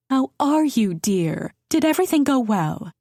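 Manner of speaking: put-on sweet older lady. A narrator's reading. Mother-in-law greeting the new mother with concern. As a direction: greet her warmly as a doting mother-in-law.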